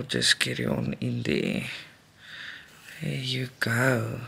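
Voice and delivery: softly breathed aside; whispered. Real, not acted. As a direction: whisper it to yourself. Quiet aside while stitching.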